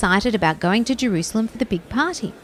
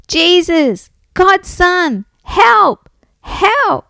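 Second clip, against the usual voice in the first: high, loud cries for help; shouting; 9 semitones higher, 6 dB louder, much slower than usual. A narrator's reading. Bart keeps calling Jesus to stop, shouting. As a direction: keep yelling to be heard. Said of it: "Bart just kept shouting".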